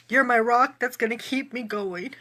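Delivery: ordinary talk, nothing marked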